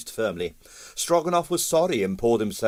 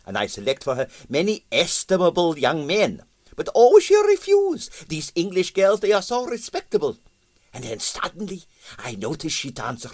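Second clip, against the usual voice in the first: put-on higher, Russian-accented, musing aloud and pleased with himself; pompous Russian impresario; 8 semitones higher than usual. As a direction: talk it through like a vain theorist. Stroganoff voiced by narrator.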